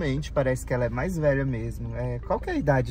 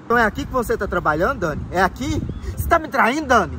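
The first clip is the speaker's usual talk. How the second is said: angry confrontation; 6 semitones higher, 3 dB louder, faster than usual. Acted. Husband catches cheating wife.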